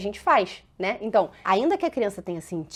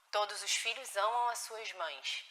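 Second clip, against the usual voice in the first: much softer, "amam" leaned on warmly; gentle reassurance; 2 semitones lower, 11 dB quieter than usual. Shown as a demonstration. Mother teaching her upset child that children love mothers, much softer. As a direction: soothe the child with the rule. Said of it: "A gente tá educando uma criança".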